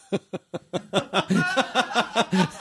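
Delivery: high helpless laugh; real laughter at the memory; burst of laughter. Real, not acted. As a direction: laugh it out hard. Laughing at his own story.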